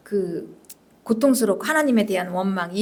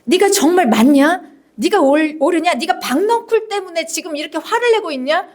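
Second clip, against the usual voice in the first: raised, pressing interrogation; reproachful God; 6 semitones higher than usual. Acted. God challenging Jonah over the gourd, indignant reproach. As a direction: scold with question after question, pitched high. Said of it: "이게 두 번째 질문이에요".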